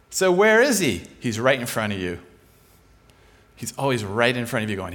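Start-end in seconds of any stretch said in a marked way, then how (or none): none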